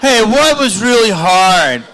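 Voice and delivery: high, loud, drawn-out mock interviewer; exaggerated comic mimicry; mocking the question. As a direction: parrot it back slowly in a high, loud voice. Mocks the interviewer's first question.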